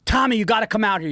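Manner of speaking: mock yell of a family member summoning Tommy; retold screaming. Relatives outside screaming for Tommy, mock yell. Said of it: "and they're screaming".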